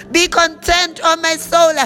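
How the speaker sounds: ordinary delivery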